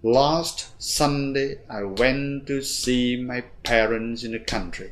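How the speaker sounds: slow, stress-timed reading; rhythmic model reading. Shown as a demonstration. Teacher models the sentence with even beats. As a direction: lean on each stressed beat slowly. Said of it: "thì chúng ta phải đọc là".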